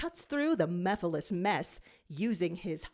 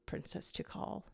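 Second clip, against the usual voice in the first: barely voiced, tender whisper; 11 dB quieter than usual. A narrator's reading. Villain whispers farewell to Princess Tikal, hushed. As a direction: breathe the name in a whisper.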